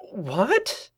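high-pitched shocked question; startled sputter. A narrator's reading. Twilight told she has friends, startled high sputter. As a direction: blurt it high in disbelief. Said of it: "Twilight sputtered, half choking on jelly".

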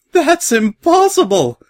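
high, loud, drawn-out cry of disbelief; incredulous shrieking mare; shouted disbelief. A narrator's reading. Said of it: "shouted a mare next to them".

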